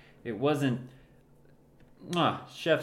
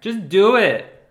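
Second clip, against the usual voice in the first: shout; 8 semitones higher, 12 dB louder, slower than usual. Real, not acted. Urging friend into machine.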